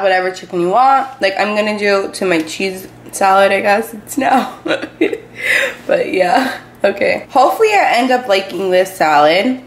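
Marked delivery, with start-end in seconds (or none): 4.6-5.8 a quick breathy laugh at herself; amused little laugh after a verbal stumble; short laugh. Real, not acted. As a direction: let a small laugh slip out. Laughs at own slip.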